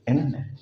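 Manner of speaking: "इंटरनेट" said as "innernet"; no T after the N in "इंटरनेट"; American-style "इंटरनेट", T left out; American silent T. Shown as a demonstration. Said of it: "टी की आवाज़ नहीं देते".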